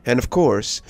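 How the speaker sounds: a spooky storyteller signing off; host sign-off. Acted. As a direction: lean in like a late-night horror host. Channel host outro after story.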